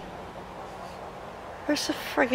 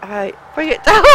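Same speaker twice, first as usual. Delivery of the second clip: ordinary talk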